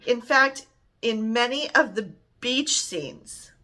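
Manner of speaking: ordinary delivery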